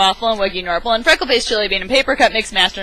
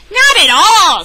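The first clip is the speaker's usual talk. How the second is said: emphatic denial; 10 semitones higher, 9 dB louder, slower than usual. A narrator's reading. King Waffle denying.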